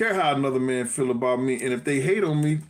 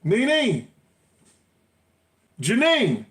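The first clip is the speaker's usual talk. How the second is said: calling names loudly through the house; yelling across the room; 11 semitones higher, 4 dB louder, slower than usual. Real, not acted. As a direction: shout up the stairs to find someone.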